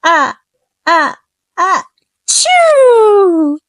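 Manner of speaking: big building sneeze, rising to a loud high burst; enormous bear sneeze. A narrator's reading. Reading to children, the bear sneezes enormously. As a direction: wind up the sneeze, then let it explode. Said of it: "gave an enormous sneeze".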